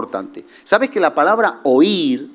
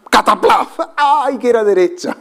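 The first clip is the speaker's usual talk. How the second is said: mock dismay; 8 semitones higher than usual. Crash after wrong turn.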